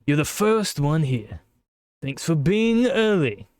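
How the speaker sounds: put-on slow, sweet welcome in a British accent. Monika welcoming the player to the club, slow British accent. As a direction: greet him like a polite British club president. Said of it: "I did give you a British accent".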